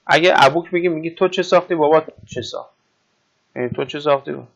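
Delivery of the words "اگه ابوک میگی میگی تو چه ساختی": ordinary talk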